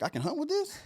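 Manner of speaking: very high, incredulous question; amazed. Retold or imitated. Novice archer asking if he can hunt with it, amazed. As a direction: re-enact astonishment, voice shooting way up.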